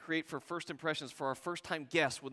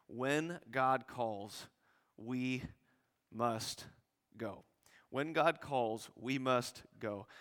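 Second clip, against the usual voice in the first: slow emphatic refrain; 3 semitones lower, much slower than usual. Shown as a demonstration. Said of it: "repeated slow emphatic refrain".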